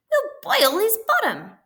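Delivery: high, loud gleeful pig voices. A narrator's reading. Pigs gloat about boiling the wolf, high and gleeful. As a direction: gloat like cheeky piglets. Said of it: "they chuckled".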